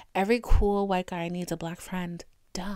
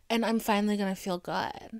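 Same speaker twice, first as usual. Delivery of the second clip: ordinary talk, nothing marked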